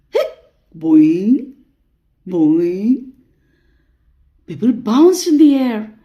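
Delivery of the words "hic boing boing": springy boing swooping upward; cartoon spring noise; bouncy hiccup.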